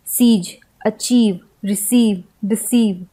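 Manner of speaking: "सीज, अचीव, रिसीव, डिसीव" with long ee. Shown as a demonstration. Teacher listing ie and ei examples, long ee. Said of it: "दीर्घ उच्चारण ई के रूप में".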